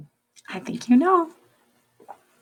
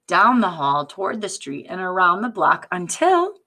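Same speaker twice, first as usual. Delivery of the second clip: usual speaking voice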